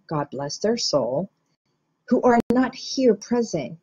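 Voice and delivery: ordinary talk, nothing marked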